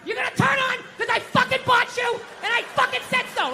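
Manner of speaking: high, strained shout at a machine; acted-out raging tantrum; screaming dad. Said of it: "don't scream like that".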